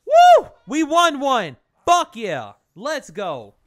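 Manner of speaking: real, very loud, high whoop of triumph; victory scream. Real, not acted. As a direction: yell with full joy after the win. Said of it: "I just screamed all up in y'all ear".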